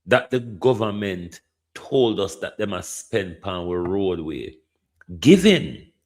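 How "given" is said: "Given" punched out loud and high; emphatic given. Real, not acted. Emphatic restart of rhetorical question.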